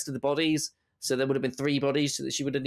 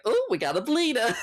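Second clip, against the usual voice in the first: mock doctor; 8 semitones higher than usual. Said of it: "and he's like".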